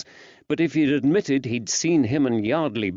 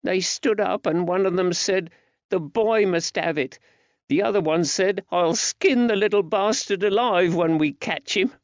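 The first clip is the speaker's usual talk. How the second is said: put-on high-pitched frightened boy; small boy confessing he ran away; scared young lad; 9 semitones higher than usual. A narrator's reading. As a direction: voice a scared child, much higher. Narrator voices young Georgie.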